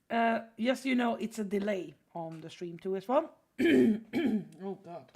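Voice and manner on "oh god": throat catching, coughing; choking up mid-sentence; choking a little. Real, not acted. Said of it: "I'm choking up a little bit".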